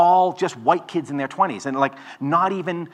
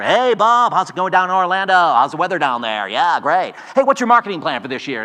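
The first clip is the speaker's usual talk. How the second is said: loud, high, chummy sales patter; Noisy Pete telemarketer; 3 semitones higher, 7 dB louder than usual. Retold or imitated. Mimicking the boiler room caller, loud and pushy. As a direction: pitch it like a pushy phone salesman. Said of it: "listen to this guy named Noisy Pete".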